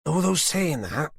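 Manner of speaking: slow, sneering mock-sweet menace; Susan's taunting voice. A narrator's reading. Susan sneering at Tanner over her hostage.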